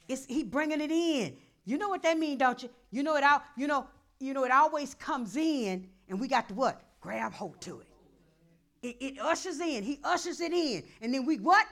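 loud call-and-response drive; preaching. Acted. Pastor calling for answers from the church, loud. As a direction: pull the answer from the crowd.

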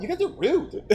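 high mock-offended whine through laughter; offended girl, imitated. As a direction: squeal it like an affronted bar girl. Offended girl quoted, teller laughing.

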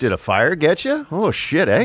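put-on Canadian accent. Co-host plays a Canadian questioning a burn victim, mock Canadian accent. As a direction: lay on a blunt Canadian accent.

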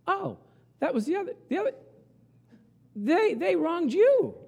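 squeaky voice pointing the blame elsewhere; high mocking voice. Spouse in counseling shifting blame, in a high mock voice. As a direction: lift way up, pass the blame off. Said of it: "they go like".

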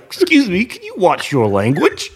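put-on high, prim old man asking politely; mock the fussy old man's request; old dude's voice. Stranger in the next seat scolding him, prim high voice. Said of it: "this old dude next to me is like".

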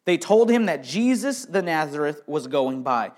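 usual speaking voice

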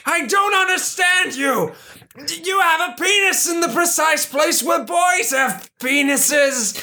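high panicked yell; panicked shout. Acted. Boss panicked outrage.

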